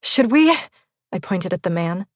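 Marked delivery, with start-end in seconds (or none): 0.0-0.5 shaking voice. A narrator's reading. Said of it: "My voice was shaking".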